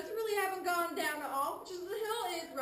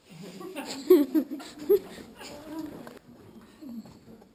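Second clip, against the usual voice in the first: short laugh; 4 semitones lower, 8 dB louder than usual. Real, not acted. Guide laughs at own pun.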